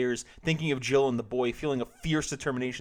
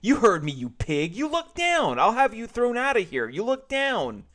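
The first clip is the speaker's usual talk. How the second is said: high, loud scolding cashier voice; angry cashier; 10 semitones higher, 8 dB louder than usual. Acted. Cashier accusing George, high angry scolding. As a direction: scold him like a caught pervert.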